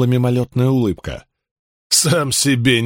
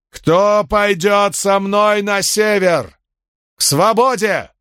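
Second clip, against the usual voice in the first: high, slow rallying cry; rallying shout; 9 semitones higher, slower than usual. Acted. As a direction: call them to follow, drawn out. Leader rallies crowd.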